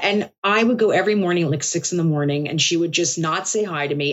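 usual speaking voice

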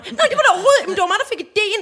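high, loud insistence, laughing; hammed-up "it was my idea"; mock show-off. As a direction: outbid the others for credit. Mocking competing grown-ups.

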